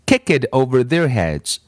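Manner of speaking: ordinary delivery, nothing marked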